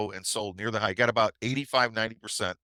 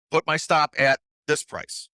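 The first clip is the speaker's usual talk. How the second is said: urgent order; 6 semitones higher, 5 dB louder than usual. Retold or imitated. Ordering on the phone.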